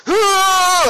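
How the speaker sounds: loud, high whoop before lifting; gym grunt. Retold or imitated. Mock weightlifter announcing his bench press with a loud whoop. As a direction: belt out a showy gym holler. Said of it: "And he goes".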